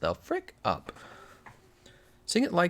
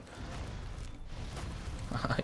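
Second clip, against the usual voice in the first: soft unvoiced snicker; whispered giggle; 12 dB quieter than usual. Real, not acted. Streamer snickering at the beetle, whispered giggle. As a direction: giggle under your breath.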